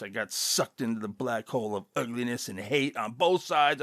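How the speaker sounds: ordinary talk, nothing marked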